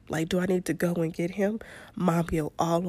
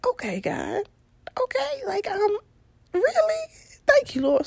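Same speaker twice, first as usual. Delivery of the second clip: squealing high with happy disbelief; overjoyed relief. Retold or imitated. Mom gets a kid-free day, squealing grateful relief. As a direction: praise the Lord like a gift just fell in your lap.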